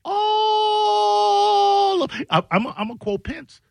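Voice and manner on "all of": ALL held very long on one high note; a high, sustained "aaall"; drawn-out emphasis. Real, not acted. Drawn-out emphatic dig at co-host.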